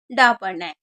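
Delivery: "डा" hit low and firm; answer stressed. Shown as a demonstration. Teacher answers her own question, stressing "डा". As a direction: land the answer "डा" with a firm fall. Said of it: "क्या पढ़ना है?".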